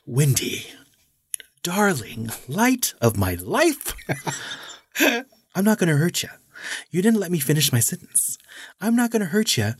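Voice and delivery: high, menacing mock-sweet madman with a laugh; Jack Torrance impression. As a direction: coo it sweetly, then threaten with glee. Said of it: "I'm gonna do this justice".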